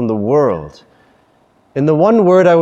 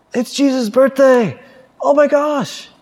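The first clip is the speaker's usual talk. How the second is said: put-on high-pitched kid-style squeal; overjoyed; 10 semitones higher than usual. Preacher acting out Christmas joy like the kid's, overjoyed. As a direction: put on the boy's giddy excitement. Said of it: "if we had that same joy".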